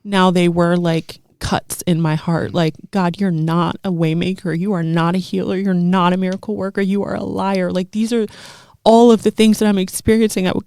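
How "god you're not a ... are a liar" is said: ordinary talk, nothing marked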